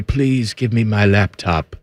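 soft, slow British-style plea; politely British and slowed right down; British accent. Acted. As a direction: feeble put-on British voice. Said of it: "I don't know why I'm British".

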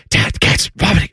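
lightly voiced kid reporting trouble; a hint of a hushed child's voice; imitated middle child. Middle daughter alerting dad, lightly imitated kid voice. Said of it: "The middle one is like".

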